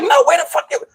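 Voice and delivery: high-pitched acted exasperation; indignant denial. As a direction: protest in a squeaky, affronted voice. Acts witch's indignant denial.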